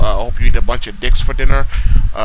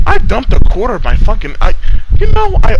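angry outburst; 11 semitones higher, 5 dB louder than usual. Real, not acted. Ranting at the clan member who cost him plat, angry outburst. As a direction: voice jumps high and loud in a rant.

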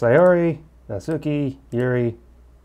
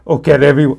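loud, hammed-up bad impression; Arnold; 3 semitones higher, 9 dB louder than usual. Gamer trying Monika's line in a loud Arnold voice. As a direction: belt it out as a rough celebrity impression. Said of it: "with my Arnold voice".

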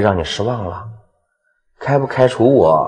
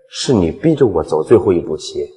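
normal talking voice

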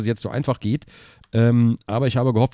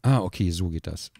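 ordinary delivery

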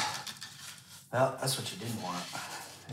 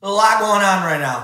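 loud; 7 semitones higher, 13 dB louder than usual. Real, not acted. Loud exclaim mid-game.

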